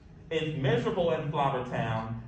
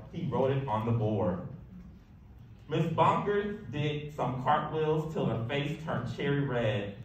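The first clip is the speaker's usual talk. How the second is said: plain reading voice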